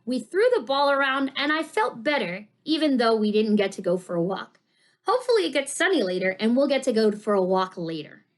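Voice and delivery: usual speaking voice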